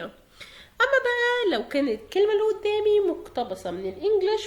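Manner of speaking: ordinary talk, nothing marked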